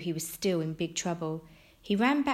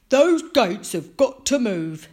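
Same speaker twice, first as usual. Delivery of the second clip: slow, loud scheming villain; Mister Bragg; 5 semitones higher, 12 dB louder, slower than usual. A narrator's reading. Mister Bragg scheming to himself, villainous. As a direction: plot aloud in a put-on villain voice. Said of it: "Mister Bragg said to himself".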